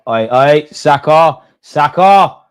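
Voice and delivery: worried shout; alarmed calling. Real, not acted. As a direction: panic as the player goes down. Alarm as player goes down.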